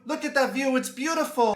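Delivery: high-pitched mock-gushing girlfriend; mock excited scream. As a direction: squeal it out in put-on awe. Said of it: "she's gonna scream something like".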